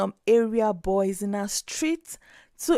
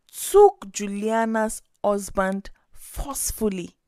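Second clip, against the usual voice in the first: ordinary delivery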